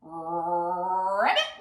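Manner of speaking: long low croak flicking up at the end; frog ribbit. Pretend. Teacher hints at frog for children, rolled croaking ribbit. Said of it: "and maybe says".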